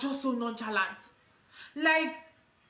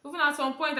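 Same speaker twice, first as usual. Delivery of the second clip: normal talking voice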